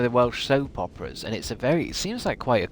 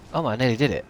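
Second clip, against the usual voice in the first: ordinary delivery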